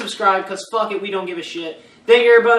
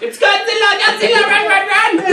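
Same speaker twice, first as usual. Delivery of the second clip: high panicked screaming, fleeing; being chased; 14 semitones higher, slower than usual. Retold or imitated. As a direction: scream in terror and run for it. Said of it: "He's like".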